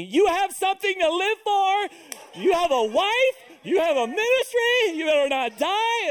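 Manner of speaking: high, loud shouted pep talk from below; a wife shouting high and loud to keep him alive; wife yelling encouragement. Retold or imitated. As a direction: yell up at him so he won't give up. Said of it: "My wife is down there encouraging me".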